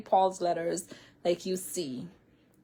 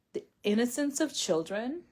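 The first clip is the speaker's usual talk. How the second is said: ordinary talk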